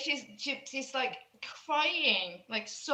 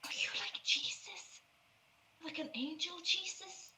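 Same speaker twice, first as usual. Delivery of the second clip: put-on whispered, higher girl's voice, full of wonder; frightened girl; 6 semitones higher, 9 dB quieter than usual. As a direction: breathe it like a scared child seeing an angel.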